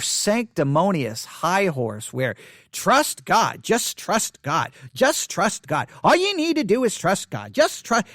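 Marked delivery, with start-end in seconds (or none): 2.7-8.0 mock condescending chant, stretched and rising as it goes. Imitating Christians brushing off worried people, condescending. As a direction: parrot a pious brush-off, talking down. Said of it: "say it in this condescending tone".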